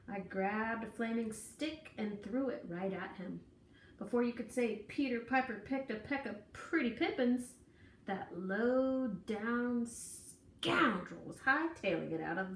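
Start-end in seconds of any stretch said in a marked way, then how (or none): none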